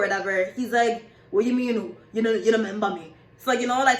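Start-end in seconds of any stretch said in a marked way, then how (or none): 1.3-3.1 imitated Jamaican patois, quick at the end. Stranger challenging a man who denies knowing him, Jamaican patois. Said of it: "talking like that".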